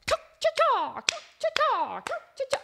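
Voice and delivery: high cha-cha-cha chicken call; high mock chicken clucks. Arrested Development chicken dance sound.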